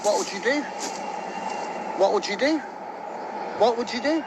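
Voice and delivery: heated. Real, not acted. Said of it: "[name] seems to have gotten very heated".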